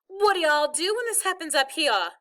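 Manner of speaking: put-on high, fretful Southern accent; worried Southern accent. A narrator's reading. Mother worried about her stuck son, worried Southern accent. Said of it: "talking Southern style when she got worried".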